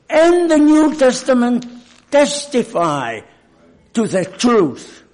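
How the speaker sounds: building to loud, high preaching; fervent preaching. Real, not acted. Preacher warns the brethren, rising to fervent loud preaching. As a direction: press the congregation with rising fire. Sermon rising to full force.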